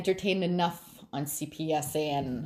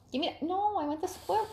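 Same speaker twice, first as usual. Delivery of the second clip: mock pet squabble; 12 semitones higher than usual. Real, not acted. Refusing the pet his squirrel toy, in high playful baby talk.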